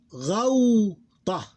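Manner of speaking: Ghawta loud and high, long gliding "ghaw" then "ta"; diphthong demo. Shown as a demonstration. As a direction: draw out the first syllable of Ghawta. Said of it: "Now the diphthong".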